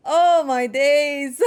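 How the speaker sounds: loud, slow and tearful; near-tears shout. Real, not acted. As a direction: shout it with the voice cracking from emotion. Overwhelmed after beating boss.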